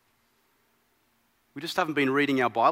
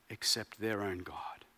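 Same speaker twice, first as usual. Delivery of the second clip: hushed whisper of awe; 8 semitones lower, 11 dB quieter than usual. Acted. King ending his praise of God, whispered. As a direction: breathe the words in reverence. Reverent close of the king's praise.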